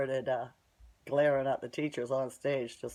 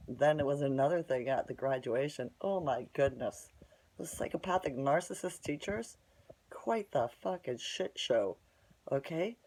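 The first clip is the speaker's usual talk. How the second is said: usual speaking voice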